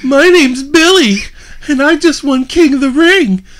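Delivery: high, loud mimicry of a whiner; mocking Billy at prayer. Wrestler ridiculing Billy's prayer, high loud mock voice. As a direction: build the fake prayer to a shout. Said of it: "and it sounded like this".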